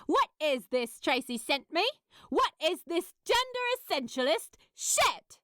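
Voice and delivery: outraged. A narrator's reading. Pepper confronting Gabriel, furious.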